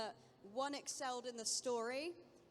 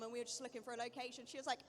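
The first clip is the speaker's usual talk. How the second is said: usual speaking voice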